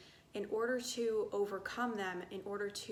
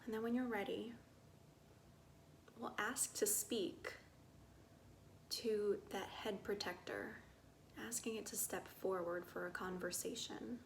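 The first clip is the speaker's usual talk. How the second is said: soft, unhurried meditation voice with long pauses; guided meditation; 6 dB quieter than usual. Acted. Guide inviting listeners to get curious about body sensations, soft meditative calm. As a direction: guide them gently inward, growing quieter.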